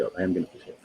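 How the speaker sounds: low, soft decision on "I am gonna push it"; quiet resolve. Real, not acted. Host changing their mind about prodding, quiet resolve.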